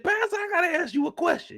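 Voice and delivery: pleading for the pastor's ear; high mock plea; imitating the man. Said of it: "He said".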